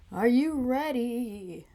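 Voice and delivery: slow, singsong "ready?"; playful drawn-out call. Acted. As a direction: stretch it out, rising high then sliding down.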